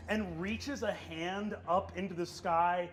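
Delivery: ordinary talk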